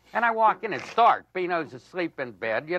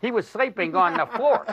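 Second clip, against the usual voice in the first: usual speaking voice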